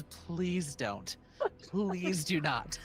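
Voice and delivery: slow polite pleading pilot; plead, drawing out the second. Acted. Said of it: "one from the pilot seat says".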